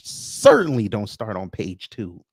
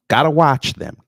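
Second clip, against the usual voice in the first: punchy, louder warning; 4 semitones higher, 7 dB louder than usual. Acted. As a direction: bark the warning out. Repeated warning.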